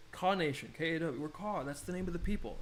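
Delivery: usual speaking voice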